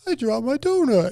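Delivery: put-on a little kid stating his loss; toddler voice. Toddler son telling dad after falling, lightly childlike and high.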